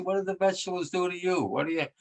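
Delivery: playful mock indignation. Mock-scolding grandkids about vegetables.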